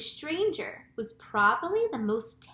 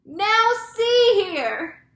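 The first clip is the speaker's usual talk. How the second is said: very slow, high, booming shout; drawn-out authoritative holler; 11 semitones higher, 12 dB louder, much slower than usual. A narrator's reading. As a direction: call up through cupped hands. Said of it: "shouted the chief of police".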